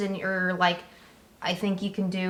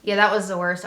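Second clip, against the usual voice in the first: usual speaking voice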